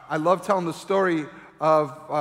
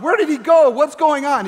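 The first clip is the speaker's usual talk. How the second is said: loud high panicked calls; mock panic; 8 semitones higher, 9 dB louder than usual. Parent whose toddler just vanished, loud mock panic. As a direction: act out a frantic parent searching.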